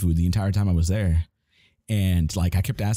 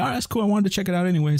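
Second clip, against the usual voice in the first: mock eager agreement; 11 semitones higher, much faster than usual. His own eager reply.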